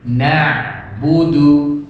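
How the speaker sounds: "Na'budu" slow, the ain flowing on without a stop. Shown as a demonstration. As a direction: let the ain in "Na'budu" carry briefly before "budu". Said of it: "ain nggak boleh berhenti".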